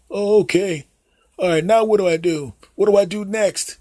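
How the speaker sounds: put-on high, hesitant old dad voice; clueless dad. Dad calling his son Jim for phone help, high and unsure. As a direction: play the fumbling father asking for help. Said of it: "You go up to them".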